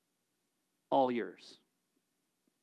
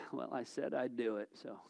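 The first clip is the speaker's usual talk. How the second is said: low, much softer reluctant grumble; mock grumbler who only keeps his word out of duty; grudging mutter; 8 semitones lower, 11 dB quieter, faster than usual. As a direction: drag the words out like a chore.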